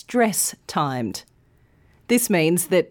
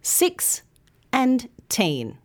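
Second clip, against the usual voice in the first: put-on "six" and "teen" said as two separate beats; syllables split apart; 3 semitones higher, slower than usual. Shown as a demonstration. Teacher splitting a word into syllables for learners. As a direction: break "sixteen" cleanly into its two syllables. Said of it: "sixteen has two syllables".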